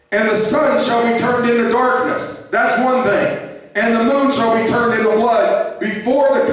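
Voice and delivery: high, ringing chant-like Bible reading; preached scripture reading. Real, not acted. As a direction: proclaim the prophecy to the congregation. Reads scripture aloud in preaching voice.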